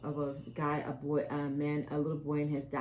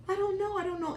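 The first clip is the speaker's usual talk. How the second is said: high, sobbing-style mock of her daughter; imitating crying daughter; 13 semitones higher, faster than usual. Mother imitating her crying daughter, high and tearful. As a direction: mimic a daughter breaking down in tears. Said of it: "you start crying, like".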